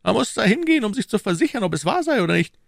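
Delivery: high-pitched, insistent questioner; the Cardinal. A narrator's reading. (hoher, fragender Kardinal)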